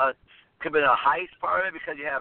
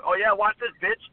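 cocky rebel taunt; 7 semitones higher, slower than usual. Pretend. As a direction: sneer it out then blow it up. Cocky rebels voiced, explosion.

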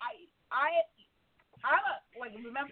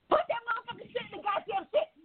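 fast, much higher furious parent order; ignorant yelling; 12 semitones higher, faster than usual. Retold or imitated. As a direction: finally lose it with the kids. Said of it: "not until you get ignorant".

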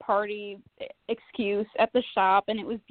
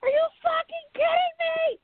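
exasperated outburst. Retold or imitated. Daughter snaps at her mom over the name, furious disbelief. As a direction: explode in outraged disbelief. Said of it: "And I was like".

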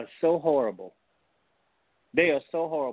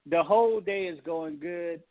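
normal talking voice

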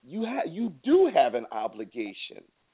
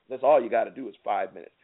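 ordinary delivery

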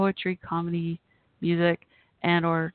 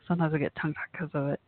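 usual speaking voice

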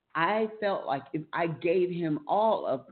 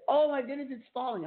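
high mock panic, falling away; retold tearful panic; 9 semitones higher than usual. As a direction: re-enact a high-pitched panicked cry. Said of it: "And I started crying".

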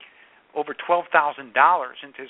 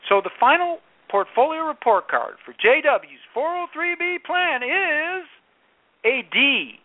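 high, slow drawn-out suspense build; dramatic reveal; 10 semitones higher than usual. Acted. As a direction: stretch it out like announcing a verdict. Dramatic report card grade reveal.

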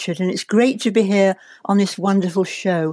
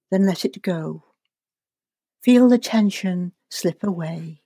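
guided meditation. Acted. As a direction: ease them gently into relaxation.

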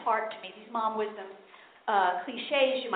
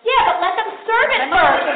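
exasperated scold; 6 semitones higher, 8 dB louder, slower than usual. Retold or imitated. Relative scolding at wedding.

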